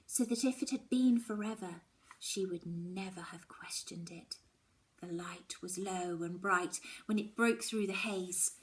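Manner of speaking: ordinary delivery, nothing marked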